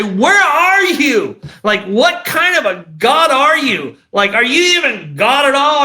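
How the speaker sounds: high, drawn-out angry cry to God; loud, incredulous challenge with big swoops in pitch; yelling at God. Retold or imitated. Addict alone in his truck pleading with God, yelling angrily. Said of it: "I would sit and yell at God".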